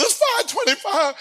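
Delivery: high alarmed blurt of the time; sudden dread in the voice; panicked boy. Retold or imitated. Boy panicking telling brother.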